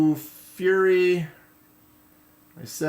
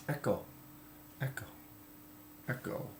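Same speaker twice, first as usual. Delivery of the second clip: name repeated softer and softer; fading echo, quieter each time; last word echo; 17 dB quieter, faster than usual. Pretend. Greets Echo, then echoes name.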